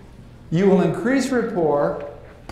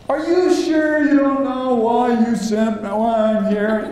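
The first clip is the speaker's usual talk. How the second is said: higher, insistent mock demand; pushy student; 6 semitones higher than usual. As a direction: badger the teacher, pitched up. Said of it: "We keep going another hour".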